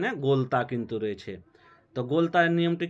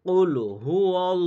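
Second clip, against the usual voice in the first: কুল হু wall chanted with a melodic dip on কুল; recited syllables; 3 semitones higher, 4 dB louder than usual. Shown as a demonstration. Teacher intoning the joined syllables for students. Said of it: "আগে আমি বানান করছি".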